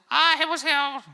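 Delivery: very high, loud mechanical echo of a toy; 말하는 선인장 흉내. Retold or imitated. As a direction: squeal it back like the cactus toy. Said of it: "저것이 자꾸 날 따라하니까". (선인장 인형처럼 아주 높고 크게 따라하기)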